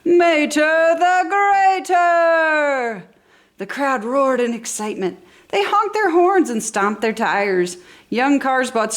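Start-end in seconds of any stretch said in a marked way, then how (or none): 0.0-3.0 slow, drawn-out stadium announcer shout; loud announcer. A narrator's reading. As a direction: boom it out to the whole stadium. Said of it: "the stadium's announcer said loudly to the audience".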